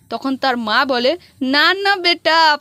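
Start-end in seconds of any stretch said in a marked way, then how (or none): 1.4-2.6 drawn-out correction. Retold or imitated. Mother corrects son's height.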